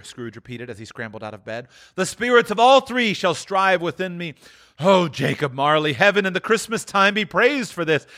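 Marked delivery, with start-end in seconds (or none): 2.0-8.0 high, glowing gratitude, building; fervent thanks. Acted. Scrooge thanking Jacob Marley, fervent high praise. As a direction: give thanks with rising fervour.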